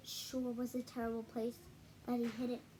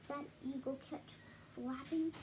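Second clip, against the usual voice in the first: ordinary delivery, nothing marked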